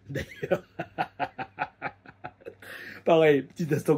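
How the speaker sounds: breaking into a laugh on the word; real chuckle before the story. Real, not acted. Laughing as he starts the Marcus anecdote.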